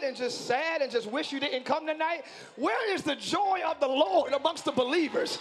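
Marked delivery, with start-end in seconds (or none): 2.5-5.4 loud, high, fervent preaching; preaching at full force. Acted. Preacher rousing a sleepy congregation, loud and fervent. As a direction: pour it out over the congregation.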